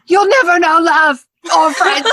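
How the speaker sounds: high, loud hammed-up taunt; dramatic Harry quote. Host's go-to Harry quote, hammed-up taunt. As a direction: fling the line like a movie climax. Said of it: "my go-to is usually".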